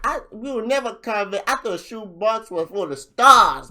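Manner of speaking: hammed-up rapper line; imitating the rapper's bar. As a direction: replay his punchline with swagger. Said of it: "he's like".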